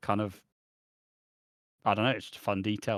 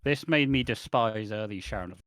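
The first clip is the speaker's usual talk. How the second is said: ordinary talk, nothing marked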